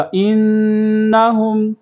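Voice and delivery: إِنَّهُمْ with the nn held nasally; ghunna on innahum. Shown as a demonstration. Teacher demonstrates ghunna in إِنَّهُمْ. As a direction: hum the nn in إِنَّهُمْ. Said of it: "یہاں پر غنہ ہوگا۔".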